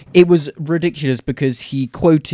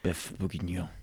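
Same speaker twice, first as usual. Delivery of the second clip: whispered beef bourguignon; 7 semitones lower, 15 dB quieter than usual. Real, not acted. Echoing the dish name.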